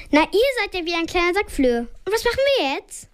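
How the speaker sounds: high, fast and rising fluster; a flustered lady calling out; hectic call. A narrator's reading. A woman in the stuck lift calls out to the kids, hectic and high. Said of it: "wedelt so ganz hektisch mit der Hand und ruft".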